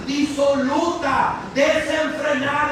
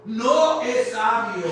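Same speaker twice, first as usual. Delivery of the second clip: slow emphatic repeat; 3 semitones lower than usual. Acted. Repeating verse slowly.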